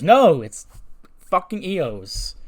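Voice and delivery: high, loud outburst with a drawn-out curse; mock angry outburst. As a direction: blow up at the name. [name] blames Io angrily.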